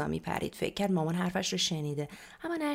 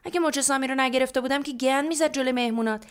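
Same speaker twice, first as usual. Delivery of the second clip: put-on high, loud teenage retort; indignant back talk; 7 semitones higher, 5 dB louder than usual. A narrator's reading. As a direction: answer mother back, voice pitched up. Daughter talks back to mother. (حاضرجوابی با صدای بلند و بالا)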